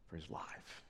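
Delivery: preacher hushing the congregation over David's flight, whispered; dramatic whisper. A narrator's reading. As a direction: whisper it, breathless with dread.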